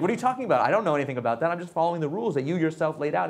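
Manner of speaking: high, loud, indignant protest; indignant team. Retold or imitated. Team defending itself to the angry founder, high indignant protest. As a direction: protest as if wrongly accused.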